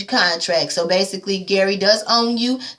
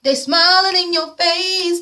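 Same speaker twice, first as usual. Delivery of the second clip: mock sweet; 8 semitones higher, slower than usual. Mocking gossiping moms.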